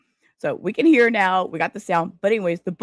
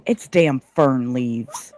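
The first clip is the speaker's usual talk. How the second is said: slow deadpan; 8 semitones lower, much slower than usual. Real, not acted. Disappointed shirt reveal.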